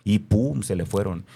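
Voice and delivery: ordinary talk, nothing marked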